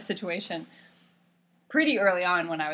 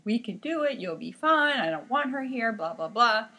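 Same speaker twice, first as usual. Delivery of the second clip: dismissive doctor; 6 semitones higher, 3 dB louder than usual. Retold or imitated. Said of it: "And he said".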